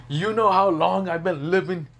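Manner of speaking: loud, high angry demand; indignant outburst. Acted. Old dragon scolds a brat who insulted him, in loud indignation.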